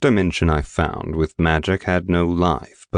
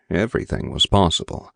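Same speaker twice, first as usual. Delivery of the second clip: usual speaking voice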